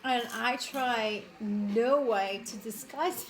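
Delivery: usual speaking voice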